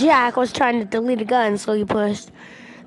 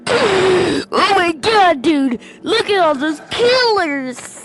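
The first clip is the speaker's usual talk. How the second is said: loud, slow, higher amazed cry; amazed shout; 5 semitones higher, 4 dB louder, slower than usual. Retold or imitated. Kid shouting at cousin over gun textures, amazed. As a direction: gasp then shout it out in wonder. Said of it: "And I was like".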